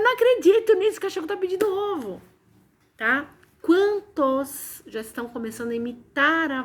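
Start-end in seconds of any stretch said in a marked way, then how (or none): 0.0-2.2 much higher and louder, astonished squeal; amazed disbelief. Retold or imitated. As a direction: squeal it out, unable to believe it. Retelling her amazement at the dog. (espanto incrédulo, agudo e alto)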